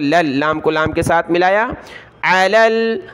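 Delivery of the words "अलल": drawn-out recitation of क़िताल; slow recitation. Shown as a demonstration. Teacher recites the phrase slowly for students. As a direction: chant it slowly.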